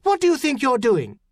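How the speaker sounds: high, shouted demand; outraged challenge, voice thrown far. A narrator's reading. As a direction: shout it at intruders on the pier. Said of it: "she shouted suddenly".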